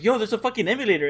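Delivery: high, rushed retold excitement; excited news-breaking. Telling a friend about the emulator, excited.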